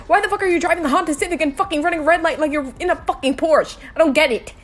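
loud building tirade, pitch climbing; angry rant. Acted. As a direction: vent at the reckless driver. Rant about Honda Civic drivers.

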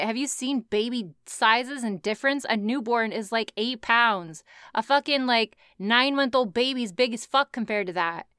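ordinary talk, nothing marked

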